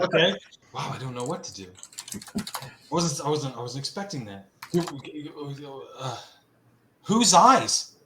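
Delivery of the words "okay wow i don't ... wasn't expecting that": ordinary talk, nothing marked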